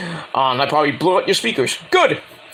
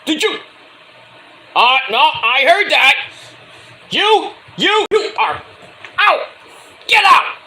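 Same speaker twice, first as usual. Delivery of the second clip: rising to a sharp mock yell on get out; imitating referee ordering out; 10 semitones higher, 3 dB louder than usual. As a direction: play the referee throwing them out, high and loud at the end.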